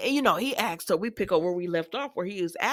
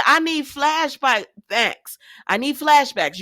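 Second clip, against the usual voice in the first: emphatic demand; 5 semitones higher, 6 dB louder, much slower than usual. Real, not acted. Host demanding flashbacks from the show.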